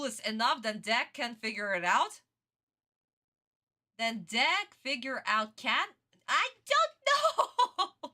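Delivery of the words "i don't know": high, drawn-out wail breaking into laughter; laughing outburst. Real, not acted. Streamer gives up on the card logic, laughing wail. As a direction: cry out helplessly, stretched high and slow. Exasperated outburst about her own question.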